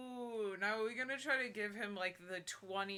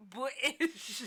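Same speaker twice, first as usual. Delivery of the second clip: high, loud mock British accent; 6 semitones higher, 7 dB louder than usual. Diagnosing the villain as British, mock British accent. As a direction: exaggerate the British pronunciation. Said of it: "British accent".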